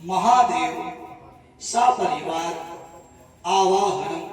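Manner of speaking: measured, word-by-word Sanskrit intoning; ritual sankalp recitation. Acted. Priest reciting the sankalp for the devotee in measured intoning. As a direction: intone each phrase of the vow with pauses between.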